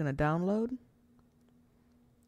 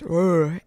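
loud, short throaty "urgh"; mock deep growl; 8 dB louder than usual. Shown as a demonstration. Vlogger imitating the voice changer's deep setting, loud growl. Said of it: "That's just me making the sound".

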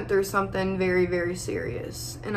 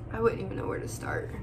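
soft, fast, breathy aside; overwhelmed and near tears; tearful sigh; 9 dB quieter, faster than usual. Real, not acted. Vlogger to viewers, overwhelmed after a sigh.

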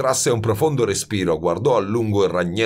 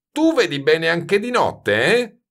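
higher, mocking question; jeering; 10 semitones higher, 4 dB louder than usual. A narrator's reading. Baldini to Grenouille about seeing at night, jeering. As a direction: taunt him with a tagged-on "eh". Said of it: "Lo schernì Baldini".

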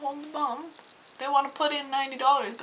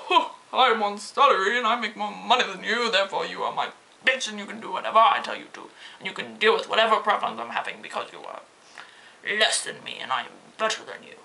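haughty, lowered condescending voice; snobby customer. Retold or imitated. Cashier mocking condescending customers, haughty snob voice. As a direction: play the entitled snob looking down.

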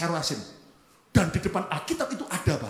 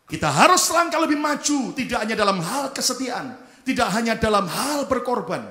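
raised, loud exhortation; full-force preaching; 6 semitones higher, 8 dB louder than usual. Real, not acted. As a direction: launch high and loud, then press on.

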